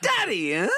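high DADDY, then a questioning HUH; emphatic DADDY tipping into a puzzled HUH; emphasis ending in question. Shown as a demonstration. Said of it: "emphasis and then ending in a question".

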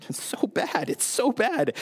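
high, breaking up with laughter; laughing. Real, not acted. Preacher reacting to bridal college joke, laughing. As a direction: squeeze the words out through a laugh.

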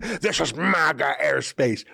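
slow, drawn-out mock holler; half-hearted retold yell; mock scream. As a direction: imitate a scream without full volume. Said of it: "The flight attendant screamed".

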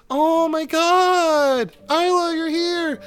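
put-on very high, loud girlish squeal; overjoyed kid sister greeting; excited little sister. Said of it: "she goes".

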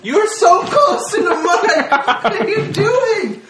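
laughing. Real, not acted. Partner chewing into the mic.

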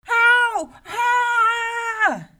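very high, loud howls of pain; giant howl of pain. A narrator's reading. Giant stabbed again from inside, howling.

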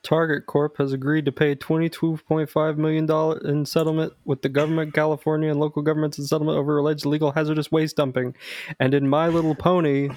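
fast, brisk newscaster delivery; news anchor read. Acted. Podcast host opening with a mock news bulletin, brisk anchor read.

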